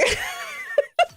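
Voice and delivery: very high, shouted burst of laughter; shrieking laugh. Real, not acted. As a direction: howl with disbelieving laughter. Laughing at the request.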